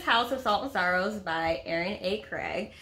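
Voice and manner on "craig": ordinary delivery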